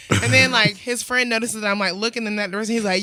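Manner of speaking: usual speaking voice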